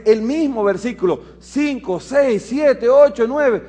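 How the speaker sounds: loud, high fervent psalm reading; preaching scripture. Acted. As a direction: proclaim the psalm over him. Lee el Salmo en voz alta. (proclamación ferviente del salmo, fuerte)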